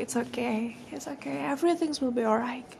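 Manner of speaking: soft, gentle reassurance to herself; soothing self-talk. Pretend. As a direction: softly comfort yourself as if hugging. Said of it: "meluk diri sendiri terus bilang kayak".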